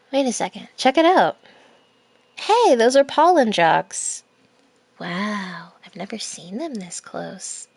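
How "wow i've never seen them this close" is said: awed hushed whisper. Acted. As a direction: breathe it out in wonder. Awed at the pollen jocks.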